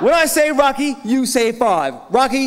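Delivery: loud, high hype-man calls; crowd call-and-response shout. Real, not acted. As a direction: pump the room up, shouting the cue.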